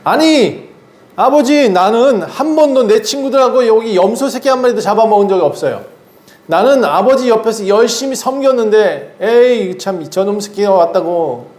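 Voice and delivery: a resentful son venting that he was never rewarded; jealous older son complaining to his father; angry older son. Acted. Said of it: "화를 막 내요".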